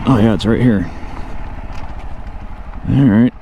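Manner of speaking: ordinary talk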